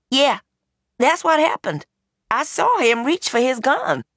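put-on eager young woman. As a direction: jump in eagerly to agree. Tegan eagerly agrees.

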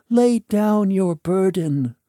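ordinary delivery, nothing marked

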